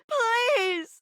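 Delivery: high pleading cry; desperate cry. Acted. Begging the player not to stop, high cry.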